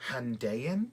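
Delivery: "Handayan" with a DAY middle, rising like a question; trying a pronunciation. Shown as a demonstration. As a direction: try "Handayan" as han-DAY-an, unsure.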